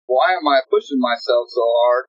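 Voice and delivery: ordinary talk, nothing marked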